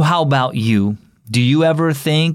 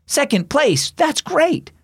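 much higher-pitched, delighted cheer; exclaimed praise; 12 semitones higher, slower than usual. A narrator's reading. Grandpa congratulating his grandson at the finish, exclaiming. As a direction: cheer the runner on his placing. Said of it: "exclaimed Grandpa, who had come to watch".

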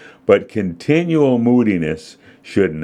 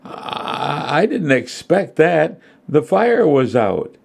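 higher, stammered shaken protest; nervous; 8 semitones higher, slower than usual. A narrator's reading. Mary explaining to Dad after the flames, nervous. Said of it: "She nodded nervously".

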